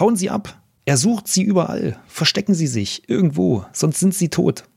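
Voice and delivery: giant's urgent warning. Acted. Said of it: "spricht dich der Riese direkt an".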